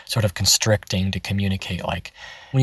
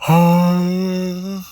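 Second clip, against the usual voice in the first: higher airy yawn; yawny voice; 9 semitones higher, 5 dB louder than usual. Shown as a demonstration. Coach shows taking his voice up to yawny. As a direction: open up into a yawn. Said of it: "like, a yawny voice".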